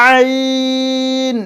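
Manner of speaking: আইন drawn long, sounded from inside the throat; আইন held out with a guttural start from the throat; throat ayn. Shown as a demonstration. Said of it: "এটা গলার ভেতর থেকে আসবে".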